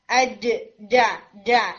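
slow, drawn-out "ڈا" from mid-tongue; demo of jeem sound. Shown as a demonstration. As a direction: sound out "ڈا" slowly from the tongue's middle. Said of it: "یہ وہ ٹنگ کا central part ہے".

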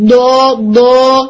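دو drawn out heavy and echoing; strong heavy ض. Shown as a demonstration. Teacher showing the blocked, echoing ض to students. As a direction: press and hold دو so it echoes in the mouth. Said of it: "یہ اس blocking کی وجہ سے آتی ہے".

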